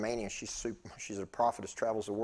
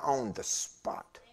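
drawn-out whispery emphasis; whispered, slow and emphatic; hushed emphasis; much slower than usual. Real, not acted. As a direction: stretch it out slowly in a half whisper. Drawn-out emphasis on sudden deliverance.

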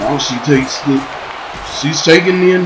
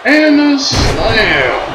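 excited; 11 semitones higher, much slower than usual. Acted. Wrestler entrance hype call.

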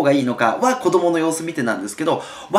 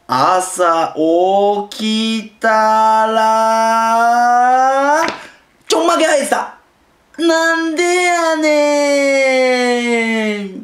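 stretched-out words, very slow, then higher; slow drawn-out reading, higher at the end; slow and fast mixed; 7 semitones higher, 4 dB louder, much slower than usual. Shown as a demonstration. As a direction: drag out each phrase for suspense. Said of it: "これ早いのとゆっくりのと混合なんですけど".

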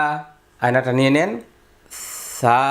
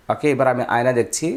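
ordinary delivery

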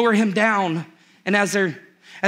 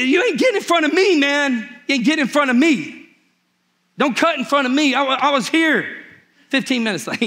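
raised, higher indignant "you ain't getting in front of me"; hammed-up line-cutting outrage; mock indignant shout; 4 semitones higher than usual. Mock line-cutter confrontation.

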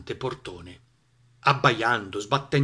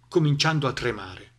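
ordinary delivery

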